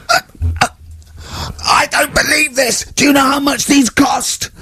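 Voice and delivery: high, strained mock rant at the class; furious teacher shouting. Teacher scolding the class over the French tapes, furious shout. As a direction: yell it like a teacher losing it. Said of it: "he went mental".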